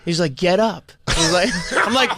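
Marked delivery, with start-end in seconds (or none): 0.3-0.9 lightly imitated jock taunt, slightly high; a hint of high-school jock goading; douche jock. Opponent taunting a fighter on his back, mild douche jock voice. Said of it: "like, douche jock".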